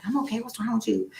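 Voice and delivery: a hint of the sister, baffled. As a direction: sister in the car, lightly voiced and puzzled. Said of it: "she was like".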